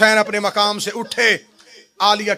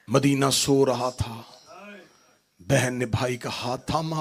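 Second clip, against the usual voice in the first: low, soft, grieving narration; soft tender recitation; 4 semitones lower, 8 dB quieter than usual. Acted. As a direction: hush the room, tender. (धीमी, नरम, दर्द भरी आवाज़ में)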